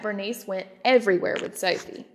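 ordinary delivery

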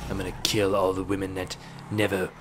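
low, soft mock British menace; British accent. Retelling a man's video threat, soft mock British accent. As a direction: imitate a video threat in quiet British tones. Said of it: "I don't know why he's going British".

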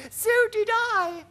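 very high, loud, slow ladybird; squeaky-high creature voice. A narrator's reading. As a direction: pipe up proudly as a ladybird. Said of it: "the ladybird said".

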